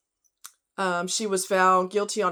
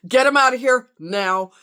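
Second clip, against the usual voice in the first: shouted order; 3 semitones higher, 7 dB louder than usual. Retold or imitated. Urgent order to clear grandkids.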